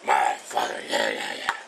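deep put-on voice. Cook muttering to himself in a deep mock voice.

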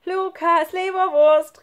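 high, loud jeer; taunting call. A narrator's reading. Schlunz makes fun of his friend, taunting call. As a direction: chant it mockingly across the yard. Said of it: "rief Schlunz und hielt sich den Bauch vor Lachen".

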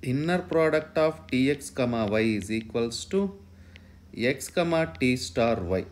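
ordinary talk, nothing marked

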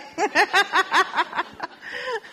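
high burst of real laughter. Real, not acted. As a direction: laugh out loud, surprised. Teacher laughs at interruption.

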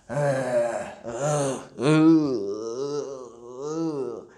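low drawn-out groans; groaning. Shown as a demonstration. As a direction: groan like a fed-up boy. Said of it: "So he's groaning".

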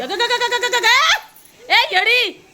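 high-pitched loud calls to stop her; high calling out. Real, not acted. Mother calling the girl away from grandma, high and loud. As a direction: call her off sharply, pitch way up.

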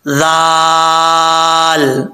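जाल held very long on one level tone; tongue at teeth edge, stretch जाल; dhal without whistle. Shown as a demonstration. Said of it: "जाल पढ़ा जाता है बगैर सीटी के".

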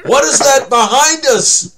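high, drawn-out cry of alarm; slow, high-pitched pretend panic; mock alarm. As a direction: call out a hammed-up warning to the party. Character alerts party to monster behind.

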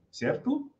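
normal talking voice

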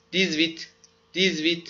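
دیزویت with the z liaison joining the words; liaison demo. Shown as a demonstration. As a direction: run دیزویت together smoothly. (دیزویت را با لیزون و پیوسته بگو)

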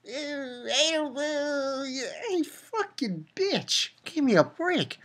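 high, slow, sneering put-on girl voice; mocking impression of a bratty girl; mocking Nana. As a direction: drag it out like a snotty brat. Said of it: "She's like".

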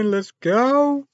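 usual speaking voice

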